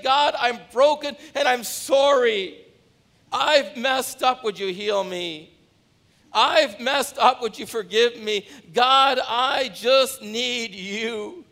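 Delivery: slow, high, loud pleading prayer; a humble plea for forgiveness, held and slow; model prayer of repentance. Shown as a demonstration. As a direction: pray it broken and earnest, slowing right down. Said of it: "you just say".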